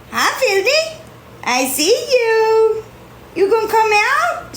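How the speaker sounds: high sing-song pet voice. Real, not acted. Baby-talking to her cat.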